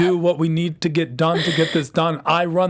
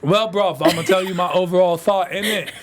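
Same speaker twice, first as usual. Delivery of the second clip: mock London roadman accent. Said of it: "he's gonna talk like that the entire show".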